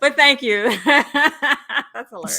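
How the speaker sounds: laughing through the words; giggly thanks, slowed by laughter. Real, not acted. Laughing at accent remark.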